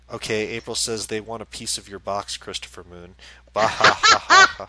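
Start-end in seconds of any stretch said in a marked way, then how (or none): 3.5-4.7 loud spelt-out "bah ha ha"; mock laugh read out. Host voicing a viewer's typed laugh, mock guffaw. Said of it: "you just said the s-, sound that she made".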